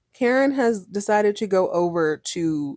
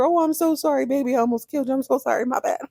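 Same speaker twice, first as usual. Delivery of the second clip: mock apologetic mother; 7 semitones higher, faster than usual. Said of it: "snapped out of her stupor".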